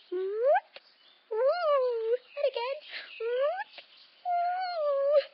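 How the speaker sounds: high whoop and woo; rising playful whoops and woos. Pretend. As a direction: whoop it up as you roll. Rocking and rolling with tummy ache.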